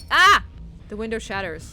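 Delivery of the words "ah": loud high startled cry; startled yell. Real, not acted. Player yelling as the window shatters, loud startled cry. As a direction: cry out in shock.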